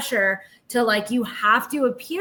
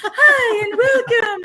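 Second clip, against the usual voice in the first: much higher, slow, laughing saccharine greeting; sugary Relief Society welcome; 11 semitones higher, 6 dB louder, slower than usual. Retold or imitated. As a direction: welcome them like an overly sweet church lady. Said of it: "That's the Relief Society voice".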